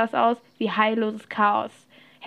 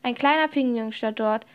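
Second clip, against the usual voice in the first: ordinary delivery, nothing marked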